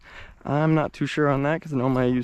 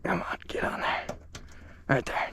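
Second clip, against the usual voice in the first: whispered concentration; threading a needle; 2 semitones higher, 10 dB quieter than usual. Real, not acted. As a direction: coax it under your breath. Fitting spring, whispering in concentration.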